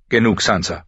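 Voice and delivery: understated impatient cut-off; sharp impatience. Acted. Father silences his complaining daughter, sharply impatient. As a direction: stop the child sharply, without shouting. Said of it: "Aus Lord Eddards Stimme klang scharf seine Ungeduld heraus".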